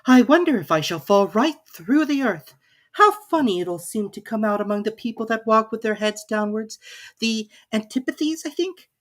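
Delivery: put-on young Alice working it out aloud, voice going up. A narrator's reading. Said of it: "Presently she began again".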